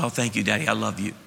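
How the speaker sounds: sweet daughter. Retold or imitated. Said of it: "She goes".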